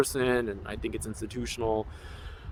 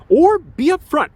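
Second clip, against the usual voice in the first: forceful demand; 10 semitones higher, 6 dB louder than usual. Real, not acted. Demanding honesty from institution.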